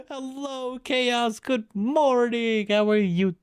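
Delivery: high, drawn-out hello; cheerful high stream greeting; sing-song greeting. Acted. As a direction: greet the chat in a lifted singsong.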